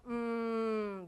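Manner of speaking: a soft, closed-mouth hum of curiosity; intrigued hum. Shown as a demonstration. Teacher shows students the sound of being intrigued, a quiet hum. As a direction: hum as if puzzled by something. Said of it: "Hacemos así".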